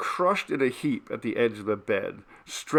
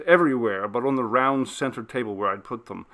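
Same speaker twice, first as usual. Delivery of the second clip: plain reading voice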